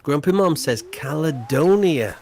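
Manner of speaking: usual speaking voice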